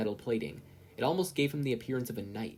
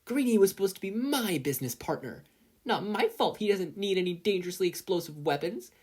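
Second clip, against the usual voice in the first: put-on higher, teasing girl's voice; taunting; 9 semitones higher, 3 dB louder than usual. A narrator's reading. Mina taunting Mei in the lab, raised and teasing. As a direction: taunt her while dodging. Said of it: "Mina taunted".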